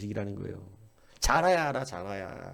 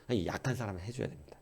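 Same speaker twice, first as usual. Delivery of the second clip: ordinary talk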